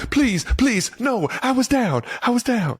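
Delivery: very high, hammed-up begging; pleading for mercy. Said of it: "pleading mercy".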